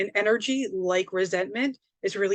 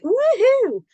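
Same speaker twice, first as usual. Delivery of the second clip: much higher, louder whoop; mock woohoo; 8 dB louder than usual. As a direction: cheer it out like a party. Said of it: "you don't have to be like".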